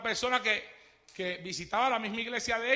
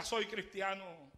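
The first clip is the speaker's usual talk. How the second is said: much softer, slow, steady declaration; soft and firm; 2 semitones lower, 9 dB quieter, slower than usual. A narrator's reading. Preacher recalls the martyr's words, much softer and slow. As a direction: say it quietly, unshaken. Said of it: "Lo único que gritaba era".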